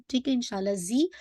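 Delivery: usual speaking voice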